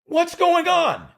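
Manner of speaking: high, slow, loud yell; imitating a yelling director. Director yelling at the stalled scene, high and loud. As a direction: stretch it out like an exasperated director. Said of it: "Hal then goes".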